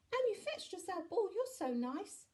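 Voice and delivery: put-on high, loud child voice; high-pitched childish shout; shouting little boy. A narrator's reading. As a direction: call out excitedly like a small boy. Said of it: "shouted Jack".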